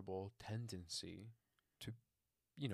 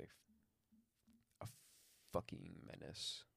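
muttered curse; 2 semitones lower, 9 dB quieter than usual. Real, not acted. Mock complaint about puppy.

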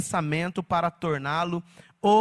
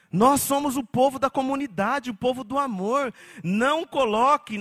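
normal talking voice

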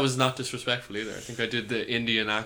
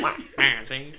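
cartoon Wario wah; a mock Wario grunt, see; Wario noise; slower than usual. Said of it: "it sounds like a Wario".

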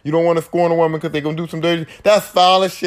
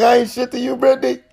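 hammed-up devastated boyfriend; crushed reply; 7 semitones higher than usual. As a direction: play the wounded man, pitch jumping up. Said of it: "I'd be like".

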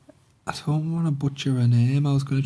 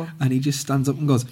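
ordinary talk

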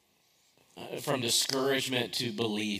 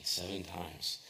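dropped, much softer echo of the count; quiet repeat; 5 semitones lower, 9 dB quieter, slower than usual. Shown as a demonstration. Pastor repeating the count to his congregation, hushed. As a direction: let the repeat sink low and soft.

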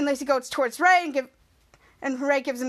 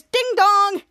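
doorbell imitation; 6 semitones higher, 5 dB louder than usual.

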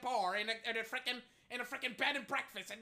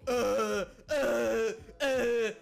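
louder, stammering dopey guy; mock the dimwit stammering; mocking dumb guy; 4 dB louder than usual. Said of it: "just stands there like".

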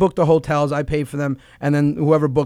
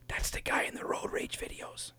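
unvoiced whisper to his brother; kid whispering; 18 dB quieter than usual. Little kid whispering to his brother about the comedian. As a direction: whisper it like a secret. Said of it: "he goes".

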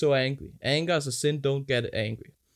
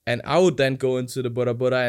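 usual speaking voice

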